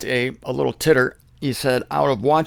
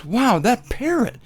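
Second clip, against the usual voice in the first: impressed guest; 6 semitones higher than usual. Retold or imitated. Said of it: "the person coming into the home thinks".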